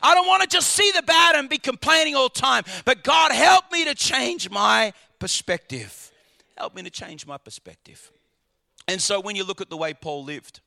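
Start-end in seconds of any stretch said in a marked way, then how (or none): 2.8-6.1 slow, loud cry to God; loud prayer. Real, not acted. Preacher cries out to God for a new perspective, slow and loud. 6.6-8.1 much lower and much softer, a hushed plea; quiet prayer. Real, not acted. Preacher repeats his prayer to God in a hushed voice.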